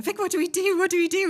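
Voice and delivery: high, loud panicky plea; frantic, asking for help; panicked colleagues. Retold or imitated. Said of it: "I remember colleagues going".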